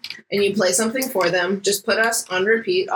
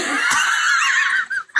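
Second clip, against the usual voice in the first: shrieking laugh. Real, not acted. Cohost cracks up at friends' teasing, shrieking laugh. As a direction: burst out in a squealing laugh.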